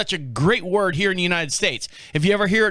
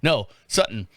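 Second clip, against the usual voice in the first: normal talking voice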